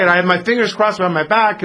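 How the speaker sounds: put-on a little kid protesting to a parent; little child's excuse. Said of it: "imagine your little son or daughter".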